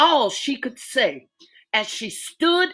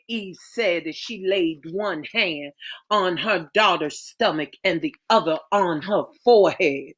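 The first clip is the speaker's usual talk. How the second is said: ordinary delivery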